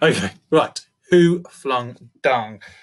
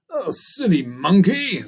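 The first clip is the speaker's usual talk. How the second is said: scornful lion; 7 semitones higher, 3 dB louder, slower than usual. A narrator's reading. Lion scoffs at the monkey.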